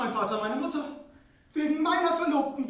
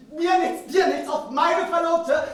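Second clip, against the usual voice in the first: slow and louder, outraged; indignant slow shout; 3 semitones higher, 8 dB louder, slower than usual. Acted. Prince protests about his father, in a slow outraged shout. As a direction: hammer each word in protest.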